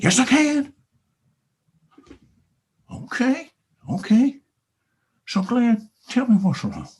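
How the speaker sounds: playful talking-cow voice. Acted. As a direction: insist like a stubborn cartoon cow. Cow Bessie talks.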